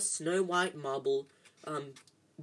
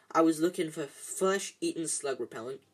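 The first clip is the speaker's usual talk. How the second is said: ordinary delivery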